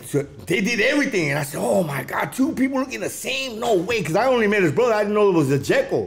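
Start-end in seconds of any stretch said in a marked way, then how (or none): 1.6-4.0 higher, incredulous; can't believe your eyes; retold stunned wonder; amazed disbelief. Realising there were twins.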